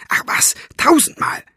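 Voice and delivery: emphatic high stage whisper. A narrator's reading. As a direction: whisper it with exasperated force. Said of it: "flüsterte sie". (nachdrücklich geflüstert)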